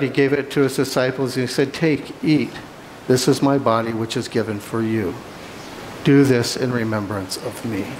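usual speaking voice

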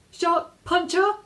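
high, slow, shaky repeat; a frightened girl repeating herself to a shark; trembling. A narrator's reading. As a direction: say it again, scared but insisting. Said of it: "she repeated, trembling".

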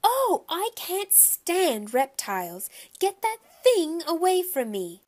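high, loud, drawn-out cry of revulsion; horrified shriek. A narrator's reading. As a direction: shriek in panic at a creature. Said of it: "Missus Yarby shrieked".

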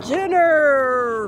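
loud bear roar. Acted. The bear lunging at Wolfie, loud roar. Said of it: "roared the bear".

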